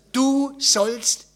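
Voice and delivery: emphatic, separated "du sollst"; stress on SOLLST. Acted. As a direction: hammer out "du ... sollst" word by word. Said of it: "sondern du sollst ein Segen sein".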